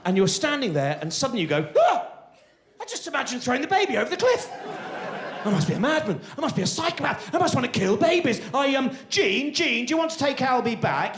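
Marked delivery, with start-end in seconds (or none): 1.8-2.1 loud, very high gasp; sudden gasp of horror at your own thought; horrified gasp. Acted. Holding a baby by a cliff, horrified gasp. 2.8-11.2 high-pitched, mounting comic panic; panicked parent. Acted. Parent holding baby near a cliff, acted panic.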